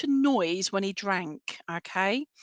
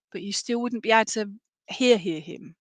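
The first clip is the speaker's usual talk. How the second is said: ordinary talk, nothing marked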